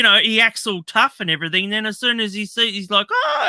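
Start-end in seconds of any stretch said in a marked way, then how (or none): 3.1-3.5 a high, sudden startled cry; mock scared yelp. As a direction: squeal like a coward fleeing. Tough guy runs off scared.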